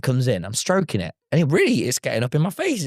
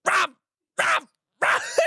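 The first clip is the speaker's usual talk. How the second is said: high, sharp repeated dog barks; short yapping bursts with gaps between; imitated angry barking; slower than usual. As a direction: bark insistently like an angry little dog. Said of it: "It just starts shouting at me".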